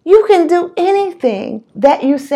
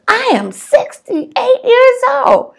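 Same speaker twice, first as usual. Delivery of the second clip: much higher and slow, a giggly squeak; laughing uncle; 13 semitones higher, 3 dB louder than usual. Retold or imitated. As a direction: stretch it out, high and tickled. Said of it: "And he just cracked up".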